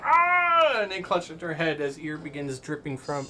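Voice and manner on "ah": high, loud "ah" of agony; pained scream. Acted. Player voicing a victim of psychic damage, pained scream. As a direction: cry out as if struck in the head.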